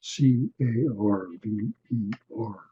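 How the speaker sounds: letter by letter, slow and clear; dictating a name to look up; spelled out letter by letter. Shown as a demonstration. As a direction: spell O N A N I slowly, one letter at a time. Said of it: "Go look her up".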